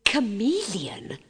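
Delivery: slow breathless whispered Chameleon. A narrator's reading. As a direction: whisper the word to the King. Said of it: "Her Highness echoed".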